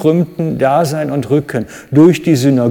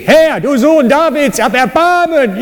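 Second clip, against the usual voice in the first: desperate shouting plea; 12 semitones higher than usual. Shown as a demonstration. Preacher acts out the blind beggars crying to Jesus, desperate shout. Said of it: "So ungefähr, nur damit ihr eine Vorstellung habt".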